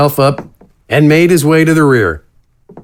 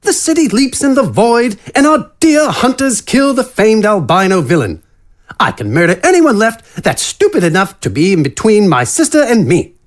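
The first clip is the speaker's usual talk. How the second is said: higher-pitched, genteel character voice; a lightly raised, courtly villain's voice; refined rogue; 8 semitones higher than usual. Acted. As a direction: play the elegant killer, pitched up.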